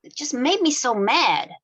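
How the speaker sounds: soft, low lovers' talk; tender flirting. Acted. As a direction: murmur it close to her. Lovers flirting after the game.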